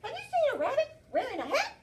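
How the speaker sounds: a squeaky small animal asking politely; squirrel voice. Acted. Teacher reading to class, the squirrel asks the bear: high squeaky voice. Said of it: "The squirrel comes along and he says".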